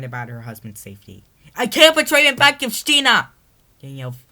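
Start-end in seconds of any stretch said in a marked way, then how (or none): 1.5-3.3 very high, loud furious shout; angry shout. Acted. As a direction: roar out your defiance. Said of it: "replied angrily".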